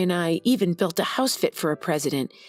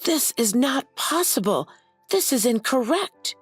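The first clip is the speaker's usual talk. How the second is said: shouting; 6 semitones higher than usual. Acted. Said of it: "began shouting".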